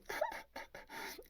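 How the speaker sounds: high, airy mock gasps; shocked gasps. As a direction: gasp in hammed-up horror. Acted shock at news of death.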